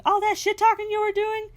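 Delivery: high, loud mock confrontation; imitated yelling. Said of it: "She's yelling".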